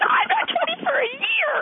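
put-on very high teenage protest; indignant daughter. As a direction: whine it like an offended teen.

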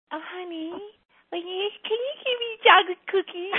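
very high, mock-cutesy wheedling; manipulative sweetness. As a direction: wheedle in a put-on sugary voice.

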